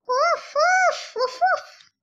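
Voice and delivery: high yappy little woofs; high dog barks. Acted. Paul the dog barking back at Arthur, high yappy woofs. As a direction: bark like an eager puppy.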